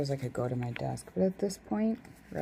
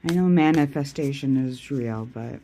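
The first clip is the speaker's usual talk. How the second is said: normal talking voice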